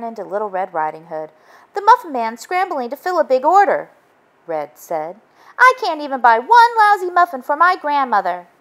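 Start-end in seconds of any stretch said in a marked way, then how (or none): 1.7-3.9 put-on higher, louder upset girl voice; Red upset. Red upset about muffins. 5.6-8.5 put-on higher, louder huffy girl; huffy complaint. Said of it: "She sniffed loudly and stomped off in a huff".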